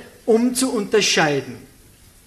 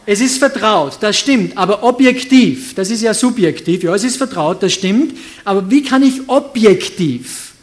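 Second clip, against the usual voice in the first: louder preaching, pressing each point; preaching at full force; 7 dB louder than usual. Acted. Preacher pressing congregation for answers, loud preaching. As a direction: drive every point home from the pulpit.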